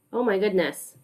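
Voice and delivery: ordinary delivery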